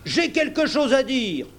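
high, urgent call; high-pitched outburst from an old seaman; old sailor's cry. A narrator's reading. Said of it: "s'écria le vieux marin".

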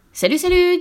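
very high, loud singsong hello; high-flying cartoon hippo hail; hippo greeting. A narrator's reading. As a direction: call out a hearty storybook greeting, pitch way up.

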